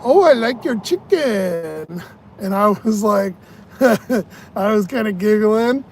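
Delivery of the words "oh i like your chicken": put-on drawn-out Mexican-accented woman, raised pitch; slow, higher Mexican accent impression; Sofia Vergara. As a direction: do a lilting Mexican accent, stretched out. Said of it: "a Sofia Vergara kind of Mexican voice".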